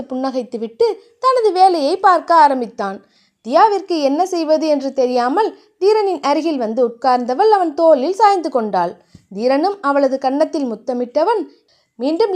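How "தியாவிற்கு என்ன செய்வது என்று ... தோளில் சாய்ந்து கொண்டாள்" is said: ordinary delivery, nothing marked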